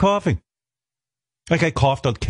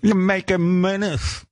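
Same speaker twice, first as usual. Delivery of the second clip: high, slow put-on nag; hammed-up disapproving mom voice; scolding mother; 7 semitones higher, slower than usual. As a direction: imitate mother's drawn-out, exasperated scolding. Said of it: "And she goes".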